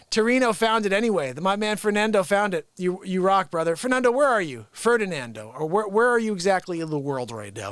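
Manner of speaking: ordinary talk, nothing marked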